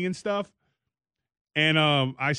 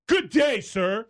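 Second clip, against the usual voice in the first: much higher and louder, a lofty snub; loud haughty good day; 7 semitones higher, slower than usual. Shown as a demonstration. Greeting Jason as an upset coworker, loud lofty snub. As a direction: bark a grand dismissive good day. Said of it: "Jason, wave to me".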